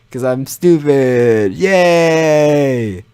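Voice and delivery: slow, then a bright mock yay; sarcastic cheer. Real, not acted. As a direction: celebrate your own dumbness. Mock self-deprecating cheer.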